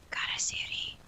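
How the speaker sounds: very soft, voiceless whisper. Acted. Healer naming the creature to visitors, whispered. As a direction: whisper the name as the old healer would. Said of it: "she whispers".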